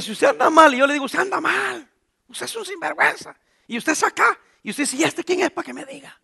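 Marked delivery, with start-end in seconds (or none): 1.1-3.3 mock scolding. Preacher voices a scolding rebuke. 5.0-6.2 offended retort. Retold or imitated. Listener's offended retort voiced.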